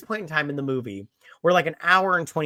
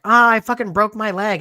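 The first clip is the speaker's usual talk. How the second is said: mock pained yell; 6 semitones higher, 7 dB louder than usual. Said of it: "he's like".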